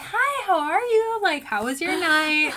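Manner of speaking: mock friendly high-pitched hello; fake sweet voice. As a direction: chirp it like you adore them. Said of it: "my fake little sweet voice".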